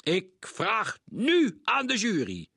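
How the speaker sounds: high, loud, slow shout; shouting judge. A narrator's reading. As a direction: call on the jury with force. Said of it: "schreeuwde meneer Krenk". (schreeuwend, traag en luid)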